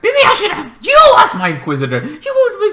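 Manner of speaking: laughing high-pitched Vader impression; Darth Vader quote. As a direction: ham up a squeaky Vader. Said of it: "Darth Vader's like".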